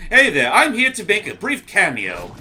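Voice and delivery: character voice. Acted. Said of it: "all [name] is here for".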